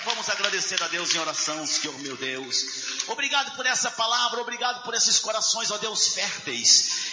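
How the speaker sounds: fervent, half-sung prayer swelling to cries; closing prayer. Acted. Pastor praying aloud over the standing congregation, fervent sung prayer. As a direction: pray over the church, rising in fervour.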